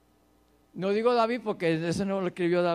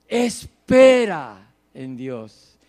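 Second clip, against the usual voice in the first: slow, booming "Espera", lower in pitch; emphatic command; 7 semitones lower, 11 dB louder, much slower than usual. Acted. Psalmist urging his soul to wait, commanding. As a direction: punch "Espera" loud and long, then settle.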